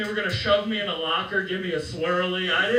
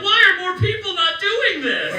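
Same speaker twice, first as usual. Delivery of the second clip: very high, loud comic shout; acted frenzy, voice way up; manic outcry; 12 semitones higher, 8 dB louder than usual. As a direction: shout it in high-pitched disbelief. Tripping, elated during laser tag chase.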